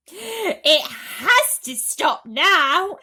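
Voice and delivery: high, loud, slow cry with a laugh. A narrator's reading. Aunt cries out at the endless growth. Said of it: "Aunt Spiker cried".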